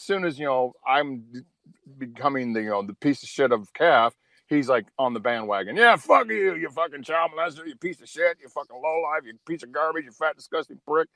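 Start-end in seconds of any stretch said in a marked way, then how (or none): none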